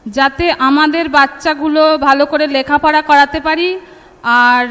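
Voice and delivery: normal talking voice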